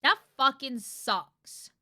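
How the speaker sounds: loud and very slow, dragged out; emphatic. Real, not acted. Streamer reacting to Amanda losing all friends, loud and dragged. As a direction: drag out every word in disgust.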